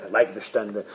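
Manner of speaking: low, soft, matter-of-fact reply; rabbi's dismissive answer. Acted. Rabbi answers the board, low and unbothered. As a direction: drop low and answer quietly. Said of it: "He says".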